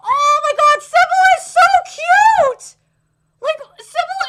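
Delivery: high, loud, slow squeal of delight; gushing fangirl squeal. Real, not acted. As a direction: squeal over how cute she is. Fangirling over transformation.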